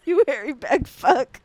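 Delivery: slow, drawn-out playful jab; mock insult.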